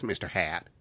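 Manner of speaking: soft, low cartoon teacher voice; Mister Garrison voice. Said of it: "Mister Garrison talks like this".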